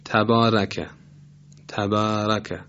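تبارکه with a thin, light ra; wrongly light ra. Shown as a demonstration. Tajweed teacher showing a mistake for contrast, light ra in تبارکه. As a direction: say تبارکه with the r and its vowel thinned, fronted. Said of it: "تبارکه رو با ترقیق ادا بکنیم".

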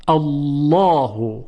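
"الله" drawn out slowly with a heavy, dark double l; full, velarized lam in "الله"; thick lam. Shown as a demonstration. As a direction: recite "الله" as a long "Allawhu".

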